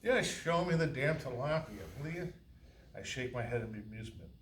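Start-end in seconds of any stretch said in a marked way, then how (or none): 0.0-2.3 amused exasperation. Acted. Amused irritated customer.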